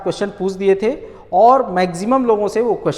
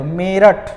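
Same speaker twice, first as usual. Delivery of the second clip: Meerut with a long, weighted ए; drawn-out, emphatic first vowel in Meerut; stress on the first vowel; much slower than usual. Shown as a demonstration. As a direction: push hard on the opening syllable of Meerut. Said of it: "इस पर आप बल दे रहे हो".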